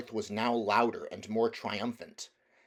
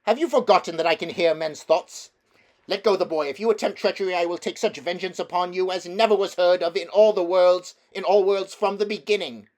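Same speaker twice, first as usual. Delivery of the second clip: loud, much higher, furious queen; menacing witch threat; 9 semitones higher, 11 dB louder than usual. Acted. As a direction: threaten him with icy fury, loud and high. Witch threatens Uncle Andrew.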